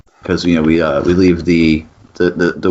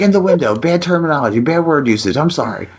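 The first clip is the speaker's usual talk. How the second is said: high, hurried-sounding mock backpedalling; retold flustered correction; 9 semitones higher, faster than usual. Husband corrects his wording to his wife, high and mock-flustered. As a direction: correct yourself in a high voice, easing into apology.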